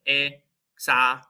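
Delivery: "examen" slowed down, stressing the open A as in car. Shown as a demonstration. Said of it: "the A in car".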